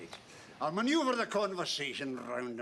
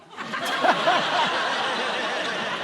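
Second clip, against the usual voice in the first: loud burst of laughter; 5 semitones higher, 11 dB louder than usual. Acted. Jones's news is broken to Godfrey.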